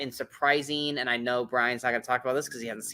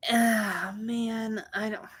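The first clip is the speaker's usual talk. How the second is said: higher, hesitant mock groan; imitated dismay; 8 semitones higher, much slower than usual. Recalling news of the studio choice, mock dismay. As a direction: replay your letdown in a higher voice. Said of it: "I was like".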